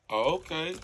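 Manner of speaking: ordinary delivery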